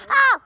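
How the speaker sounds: sudden sharp ow; pained yelp. Real, not acted. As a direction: cry out in pain. Hurt on the trampoline.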